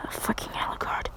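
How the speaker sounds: sigh, then whisper the curse; exasperated whisper. Retold or imitated. Woman fed up with Alucard, whispered exasperation. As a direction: breathe it out under your breath, fed up.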